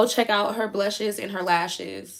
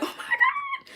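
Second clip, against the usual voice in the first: amazed; slower than usual. Retold or imitated. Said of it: "I be like".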